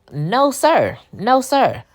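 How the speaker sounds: high, loud sassy refusal; firm no sir. Real, not acted. Host scolds the jailed man, high, loud and sassy. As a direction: shut him down with sass.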